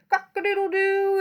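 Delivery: high, loud crowing; rooster crow. Acted. The flustered fowl crows when woken, loud crow. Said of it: "the fowl who crowed".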